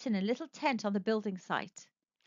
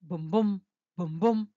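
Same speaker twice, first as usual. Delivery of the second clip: low thudding booms; 4 semitones lower than usual. A narrator's reading. Heartbeat sound repeated.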